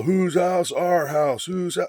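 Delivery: high-pitched mock chant; imitated crowd yell. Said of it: "a couple of them are starting to yell".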